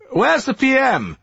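high, tinny voice over a bad line; faint robotic telephone voice; robot phone voice. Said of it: "robot voice".

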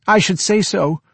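high, blunt cleaning woman voice; a brash charwoman's reply; chatty grumbling charwoman. Acted. Charwoman answers Frau Samsa.

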